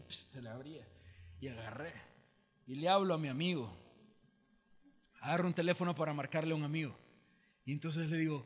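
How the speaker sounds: usual speaking voice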